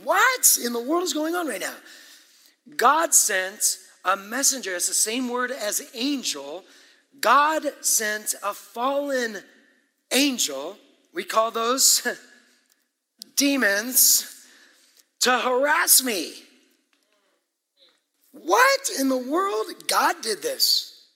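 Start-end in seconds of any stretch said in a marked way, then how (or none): 0.0-1.8 high, loud-edged disbelief; stunned outburst, pitched way up; astonished. Acted. Reacting aghast to the verse. 18.3-21.1 slow, high, loud disbelief; flabbergasted preacher's exclamation; astonished. Acted. Reacting aghast to God's action.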